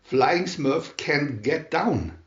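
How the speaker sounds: ordinary delivery, nothing marked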